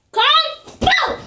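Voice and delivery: high playful fighting shout; mock fight cry. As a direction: act out a punch, shouting.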